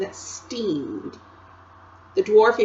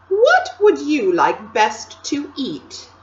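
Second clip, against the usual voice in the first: slow, high, louder tempting queen; queenly voice; 7 semitones higher, 7 dB louder, slower than usual. A narrator's reading. Queen tempting Edmund, slow and high. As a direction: coax him slowly toward the sweets.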